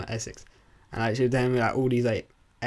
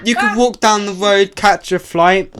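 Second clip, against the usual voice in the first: high, loud and laughing with delight; giddy, laughing excitement; excited; 9 semitones higher, 8 dB louder than usual. Real, not acted. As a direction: gush about walking to catch a flight. Excited about airport nearby.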